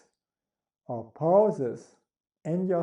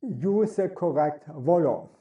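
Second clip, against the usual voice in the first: ordinary delivery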